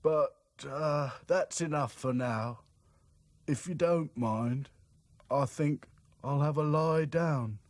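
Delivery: slow, hesitant mopey voice; weary doll. A narrator's reading. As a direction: trail off tiredly. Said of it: "said Sad Sack".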